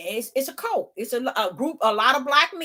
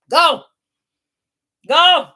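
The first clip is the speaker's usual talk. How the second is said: two loud shouts of go, each higher and louder than her talk; loud dismissive go; 8 semitones higher, 12 dB louder than usual. Acted. To cousins bent on Africa, loud shooing shouts. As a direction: shout it like waving them off.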